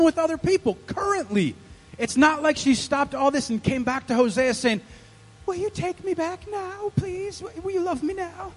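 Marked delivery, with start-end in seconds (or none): 5.4-8.5 tiny, high, soft pleading voice; pleading wife. Acted. Unfaithful wife begging her husband to take her back, pleading. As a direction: plead softly in a mock-sweet high voice. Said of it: "That's how she talked".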